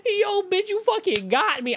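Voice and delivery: high, hyped-up inner yell; imitating a gleeful silent cheer, pitched high; excited inner cheer. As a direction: cheer your coworker on in your head. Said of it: "I wanted to be like".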